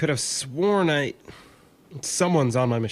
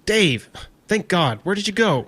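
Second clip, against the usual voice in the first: relieved but shaken; 6 semitones higher, 5 dB louder than usual. Acted. Relief after panic.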